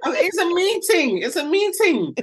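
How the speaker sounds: high, laughing through the excuse; laughing at her own cover story. Real, not acted. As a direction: giggle while insisting it's a meeting.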